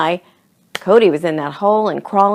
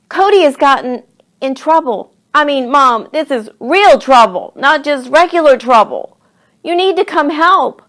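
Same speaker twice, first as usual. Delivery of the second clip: put-on raised, loud, insistent child's report; urgent pleading; 7 semitones higher, 6 dB louder than usual. A narrator's reading. Charlie begs Mom to help Cody, urgent and raised. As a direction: press each phrase hard, high and loud.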